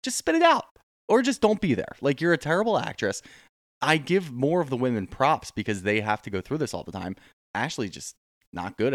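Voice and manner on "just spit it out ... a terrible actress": mock exasperated outburst, pitched way up; imitating yelling at a bad actress on TV; exasperated rant. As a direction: snap at her like she's wasting everyone's time.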